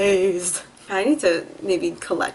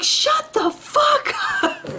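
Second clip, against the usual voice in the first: retold yell; much slower than usual. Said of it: "as loud as I could".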